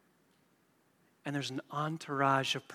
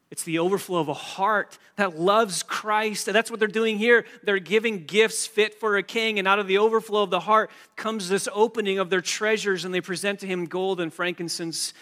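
loud, driving preacher's voice, climbing higher near the end; preaching at full force; 5 semitones higher, 9 dB louder than usual. Acted. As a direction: preach it to the back row, building. Preacher urges heartfelt giving.

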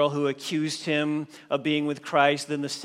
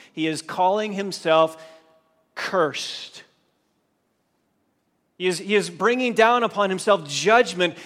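normal talking voice

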